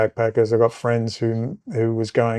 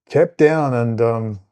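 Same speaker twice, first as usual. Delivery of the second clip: ordinary talk, nothing marked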